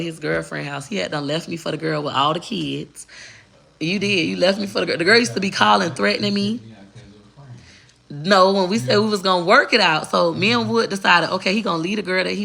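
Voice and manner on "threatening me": ordinary talk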